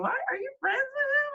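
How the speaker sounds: high mimicking voice; mocking other kids. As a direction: voice the nosy kids. Mimicking people questioning her.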